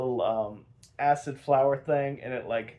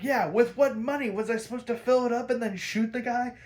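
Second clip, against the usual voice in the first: Harley talking back; 9 semitones higher than usual. Retold or imitated. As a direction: fire back at him with attitude. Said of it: "And she's like".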